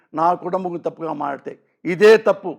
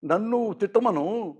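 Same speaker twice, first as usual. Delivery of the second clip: quieter, slow, hurt pleading; imitating tearful Chiranjeevi, quieter; 7 dB quieter than usual. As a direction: quote a man close to tears, softly. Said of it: "కన్నీళ్ళు పెట్టుకుని మాట్లాడుతుంటే".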